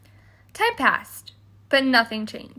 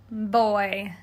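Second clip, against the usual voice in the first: usual speaking voice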